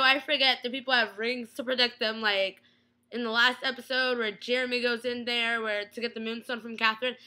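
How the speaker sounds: normal talking voice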